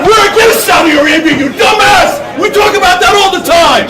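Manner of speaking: loud furious retort; angry shouting. Real, not acted. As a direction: shout him down in anger. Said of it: "you're pissed".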